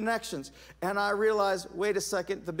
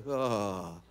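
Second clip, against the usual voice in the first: disgusted groan; 10 semitones lower than usual. Retold or imitated. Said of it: "And I was like".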